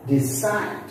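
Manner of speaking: "decide" rising onto a strong CIDE; stress on second syllable. Shown as a demonstration. Teacher listing verbs for learners, second-syllable stress. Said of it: "give stress on the second syllable".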